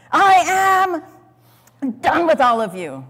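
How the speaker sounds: loud, angry dismissal; fed up. Pretend. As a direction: throw it at them in anger.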